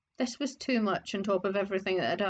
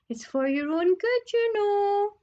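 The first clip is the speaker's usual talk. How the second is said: mock saintly; 10 semitones higher, 4 dB louder than usual. Peeves still mocking.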